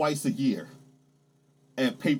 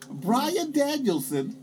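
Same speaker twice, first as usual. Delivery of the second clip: excited drawn-out name; 10 semitones higher, much slower than usual. Real, not acted. Excited listing of free agents.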